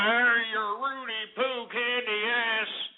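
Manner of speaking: slow, high mocking wrestler voice; wrestler trash talk. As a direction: sneer it like a wrestling promo. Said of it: "you're sitting here calling".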